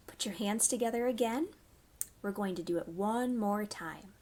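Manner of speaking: ordinary delivery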